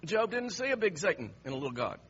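normal talking voice

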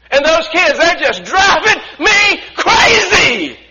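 loud, high mock griping building to a shout; hammed-up complaining. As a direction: act fed up, climbing to a stretched-out yell on crazy. Acts out people's morning complaints.

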